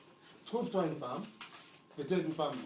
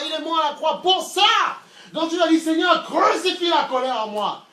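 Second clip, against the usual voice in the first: preaching louder and louder, building to full force; rising sermon fervour; 6 semitones higher, 8 dB louder than usual. Acted. Preacher to the faithful, building to a loud fervent climax. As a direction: drive it home like a pulpit climax.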